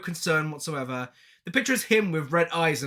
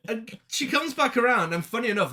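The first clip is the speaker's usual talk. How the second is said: usual speaking voice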